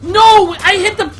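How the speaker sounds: real frustrated scream, high and loud. Real, not acted. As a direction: scream in protest at a botched move. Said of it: "I'm sorry for screaming".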